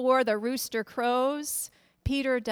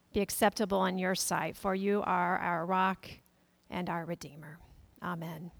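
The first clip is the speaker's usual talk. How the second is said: prayer; 3 semitones lower than usual. Acted. Pastor praying for the congregation, hushed.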